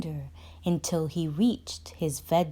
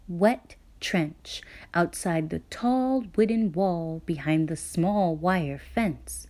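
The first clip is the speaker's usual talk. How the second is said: plain reading voice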